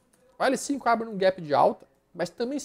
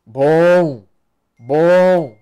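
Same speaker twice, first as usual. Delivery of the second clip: loud approval; 14 dB louder than usual. Real, not acted. Approving good charts.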